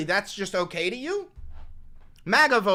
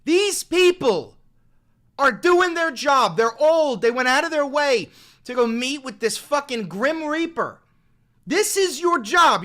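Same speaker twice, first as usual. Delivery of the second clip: rallying shout; 5 semitones higher, 7 dB louder than usual. Real, not acted. Rallying the stream audience.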